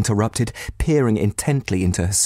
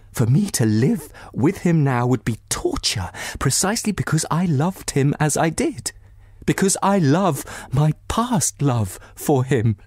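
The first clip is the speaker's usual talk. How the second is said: near sobbing; 7 semitones higher than usual. Acted. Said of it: "sobs interrupted her words".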